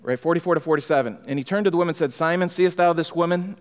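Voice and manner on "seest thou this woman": ordinary delivery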